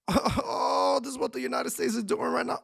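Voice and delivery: high, drawn-out mock "oh" taunts; mock taunting. They rush at you talking back, high mock taunting. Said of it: "They're gonna rush at you and be like".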